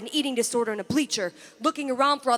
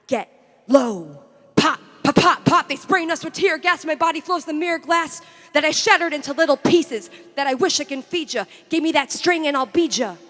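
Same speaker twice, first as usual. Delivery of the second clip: raised, punchy, urgent verse; loud spoken-word climax; 6 semitones higher, 6 dB louder than usual. Acted. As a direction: burst out and drive the rhythm hard. Poem climax with gunfire pops.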